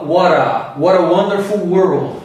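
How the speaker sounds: American linking, "What a" with T between R and D; slow American delivery, flap the T into the vowel; American accent. Shown as a demonstration. Teacher demonstrating linked words, American accent. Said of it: "ele fala".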